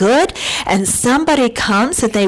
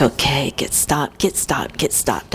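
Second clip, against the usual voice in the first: tense muttering; 7 semitones lower, 6 dB quieter than usual. Retold or imitated. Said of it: "I'm like".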